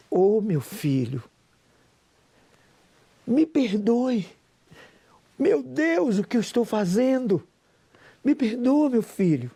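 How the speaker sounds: a mildly tearful mother begging her son's forgiveness; a mother, just starting to cry, gently apologizing to her son; mother tearful apology. Retold or imitated. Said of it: "E minha mãe começou a chorar".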